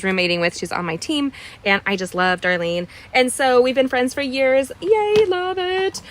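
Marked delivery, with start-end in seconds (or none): 4.8-6.0 excited cheer. Real, not acted. Cheering about old friendship.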